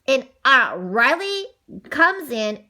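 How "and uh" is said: plain reading voice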